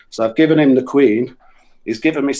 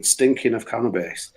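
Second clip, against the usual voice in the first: ordinary delivery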